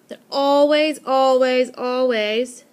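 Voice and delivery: insisting on always three times; emphatic always. Shown as a demonstration. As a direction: drive home always, always, always. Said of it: "always the same rhyme".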